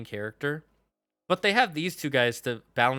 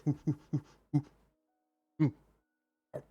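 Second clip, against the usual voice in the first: monkey hoots; 3 dB quieter, slower than usual. Retold or imitated. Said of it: "He would be acting like a monkey".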